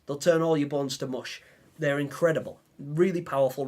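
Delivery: normal talking voice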